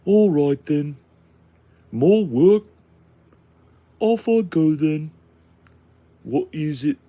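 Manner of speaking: loud, high British farmer grunt-worker; Warcraft peon. Acted. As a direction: call out short lines like a game minion. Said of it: "basically like a peon from Warcraft".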